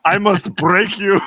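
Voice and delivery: slow, high, loud mock Russian; hammy Drago voice growled slow; Russian accent. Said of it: "Russian accent".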